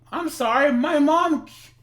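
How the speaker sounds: put-on high, apologetic girl voice; voiced young girl.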